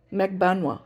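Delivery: Magbanwa with open ah in the middle, three syllables; three-syllable pronunciation. Shown as a demonstration. Repeating the relative's name pronunciation, three syllables. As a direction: repeat Magbanwa as mag-BAN-wa. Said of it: "Almost like three syllables, not four".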